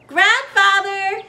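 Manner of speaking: slow, high, loud happy call; called out happily. A narrator's reading. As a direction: call across the house, glad. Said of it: "Eva called out happily".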